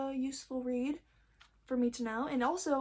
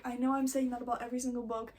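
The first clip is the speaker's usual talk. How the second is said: ordinary talk, nothing marked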